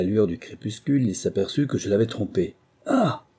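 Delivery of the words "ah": sharp high gasp of fright; frightened high ah; startled cry of fright. A narrator's reading. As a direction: jump at the sight of him. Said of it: "s'écria-t-il avec un mouvement d'effroi".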